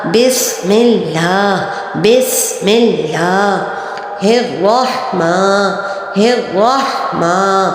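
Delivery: بسم اللہ then الرحمن, each slow and light; slow practice recitation. Shown as a demonstration. Teacher drilling the basmala word by word. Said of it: "practice کیجئے".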